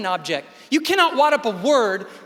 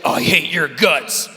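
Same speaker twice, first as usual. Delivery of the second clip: mean person; 7 semitones lower, 3 dB louder than usual. Retold or imitated. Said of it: "the mean person might say".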